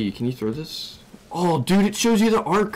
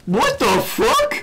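shocked; 3 dB louder, much slower than usual. Real, not acted. Sudden creature appears.

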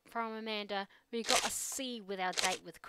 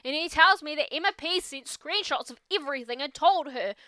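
a lightly voiced teenage girl venting; teen daughter voice; 3 semitones higher, 14 dB louder than usual. Teen daughter venting to her dad, lightly voiced. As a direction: play it as an upset teen unloading. [name] retelling the drama.

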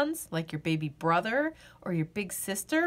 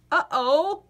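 playful uh-oh; 5 semitones higher, 6 dB louder than usual. Acted. Yawn coming on.

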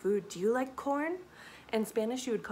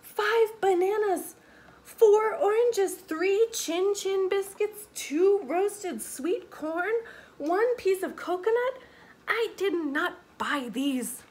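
high, slow, incredulous listing; astonished cry; 9 semitones higher, 5 dB louder, slower than usual. A narrator's reading. Mama listing food she didn't buy, astonished. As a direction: count each item out in loud disbelief. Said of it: "cries Mama".

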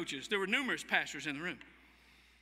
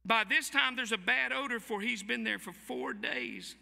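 protesting; 3 semitones higher, slower than usual. Acted. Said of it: "and we're".

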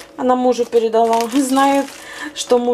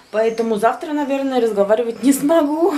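ordinary delivery

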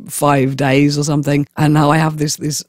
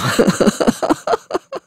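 high wheezing laugh; helpless laughter; 11 semitones higher than usual. Real, not acted. Performer laughs at their fisherman tale, helpless laughter. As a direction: crack up after the act. Cracks up after sea tale.

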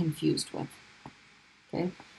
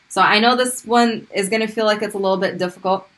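ordinary talk

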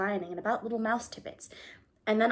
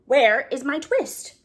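insistent, louder; 5 semitones higher, 8 dB louder, slower than usual. Acted. Tailor presses Simpkin again.

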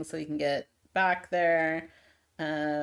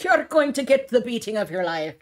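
put-on Scottish accent that slides into Irish, louder; attempted Scottish accent; 4 semitones higher, 5 dB louder than usual. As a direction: threaten in a botched Scottish accent. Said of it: "That's Irish, that's not Scottish".

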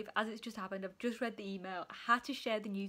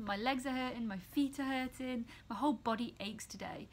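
ordinary delivery

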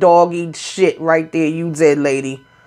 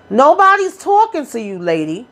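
high, pitched-up yell at the lady; mock yell; 6 semitones higher than usual. Retelling the clash, a high mock yell at the lady. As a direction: snap it out high and sharp. Retold shout at the mama.

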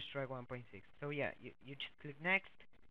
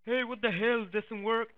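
loud, higher-pitched mock complaint; angry commenter; 6 semitones higher, 10 dB louder than usual. Viewer complaining in the comments that it doesn't work, mock angry. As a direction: fire it off as an irate complaint. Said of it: "if you just comment like".

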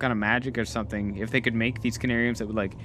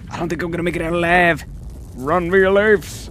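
panicked; 3 semitones higher, 6 dB louder than usual. Acted. Escaping the collapsing place.

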